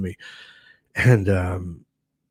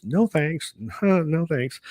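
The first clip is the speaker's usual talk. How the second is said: high-pitched mock refusal with a laugh; incredulous refusal; 7 semitones higher, much slower than usual. Patient refusing a dreaded diagnosis, incredulous high refusal. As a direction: turn it down in a higher voice, half laughing.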